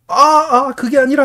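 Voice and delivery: 훨씬 높고 크게 터뜨리는 항변; 남의 말을 끊듯 높게 외치기; 다급한 외침 흉내. Retold or imitated. 화내고 나가는 장면 재연. (버럭 외치는 재연)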